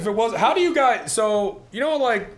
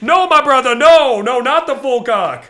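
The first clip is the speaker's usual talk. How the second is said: higher and louder, slowing at the end with a laugh; hammed-up outrage; mock angry yell; 8 semitones higher, 10 dB louder, slower than usual. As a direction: play the offended guy shouting no. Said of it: "they'll get mad".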